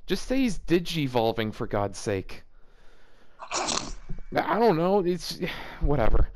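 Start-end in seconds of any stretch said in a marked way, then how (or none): none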